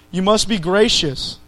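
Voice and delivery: ordinary delivery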